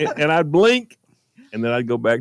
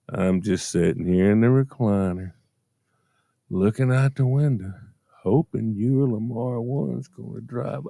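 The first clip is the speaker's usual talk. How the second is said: imitating Mom; 3 semitones lower than usual. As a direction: drag it out like a lonely mother on the phone. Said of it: "Is this the response?".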